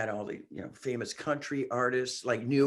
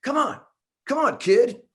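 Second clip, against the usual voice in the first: high, loud coaxing call; mentor's eager call; 12 semitones higher, 7 dB louder than usual. Retold or imitated. Headliner calling the young singer up onstage, eager and high. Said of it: "And he goes".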